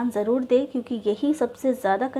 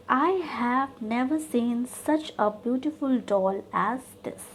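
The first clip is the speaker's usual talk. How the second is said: slow dictation; much slower than usual. Shown as a demonstration. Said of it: "इसमें भी आपको degree change करना है".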